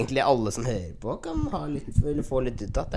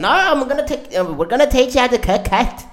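higher-pitched mock British lad; eager British lad; 7 semitones higher, 4 dB louder than usual. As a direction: imitate an excited mate insisting in English. Retelling Brits' eager offer.

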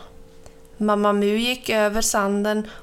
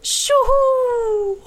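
very high, long hooting cry; gleeful, sustained high holler; joyful whoop; 14 semitones higher, 4 dB louder than usual. A narrator's reading. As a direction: whoop it out high as you leap. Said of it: "ropade Mamma Mu".

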